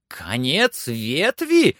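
slow, high cry of a question; exclaiming cry. A narrator's reading. Master hears climber reached the end, exclaiming cry. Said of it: "вскричал Легран".

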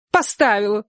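high-pitched female character; reply briefly as the wife, pitched high; woman's voice. A narrator's reading. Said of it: "ответила женщина". (высокий женский голос)

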